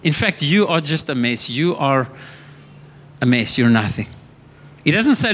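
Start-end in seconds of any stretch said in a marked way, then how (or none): 0.0-4.1 low, taunting accuser; a low, scornful voice of the devil; devil's accusing voice. Retold or imitated. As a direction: voice the devil sneering at you. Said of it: "He says".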